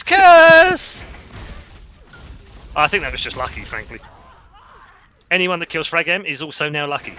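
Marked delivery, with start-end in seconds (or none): 0.0-1.0 loud, slow, high exclamation; drawn-out shout; exclaiming. Real, not acted. Play-by-play after a miss.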